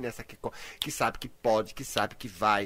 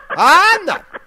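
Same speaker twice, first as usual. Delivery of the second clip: mock outraged cry; 14 semitones higher, 14 dB louder, slower than usual. Host shooing away the cowardly side.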